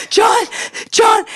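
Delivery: high, gasped cry for help; hammed-up breathless summons; faked hyperventilating. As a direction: call out in put-on panic. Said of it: "heaving and hyperventilating. And I go".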